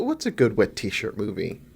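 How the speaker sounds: ordinary talk, nothing marked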